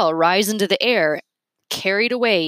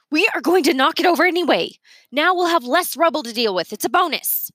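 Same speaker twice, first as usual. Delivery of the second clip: much higher, fast and louder; shrewish Miss Sparks; 9 semitones higher, 3 dB louder than usual. A narrator's reading. Miss Sparks overriding Yancey, high, quick and smug. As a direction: press it home, smug.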